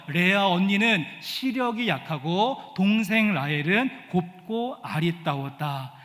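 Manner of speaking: ordinary delivery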